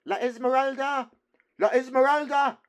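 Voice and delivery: high, loud chanted cry of the name; whole hall shouting the name as they rush; crowd shouting. A narrator's reading.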